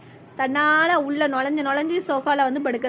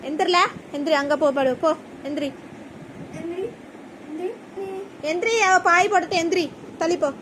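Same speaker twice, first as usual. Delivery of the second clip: scolding a pet; 4 semitones higher than usual. Real, not acted. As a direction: shoo the pet off firmly. Shooing pet off the sofa.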